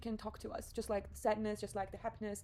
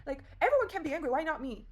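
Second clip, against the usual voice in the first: exasperated, higher-pitched protest; angry at herself; 5 semitones higher, 5 dB louder than usual. Retold or imitated. Arguing for her right to anger, exasperated. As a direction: protest the unfairness, voice jumping up. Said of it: "I was so angry at myself".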